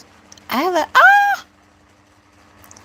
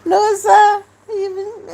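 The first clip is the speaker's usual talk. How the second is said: laughing and crying; 11 semitones higher than usual. Real, not acted. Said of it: "I laugh and I cry at the same time".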